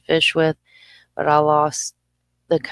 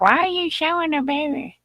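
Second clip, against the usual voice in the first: imitating husband; 11 semitones higher than usual. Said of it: "He'd be like".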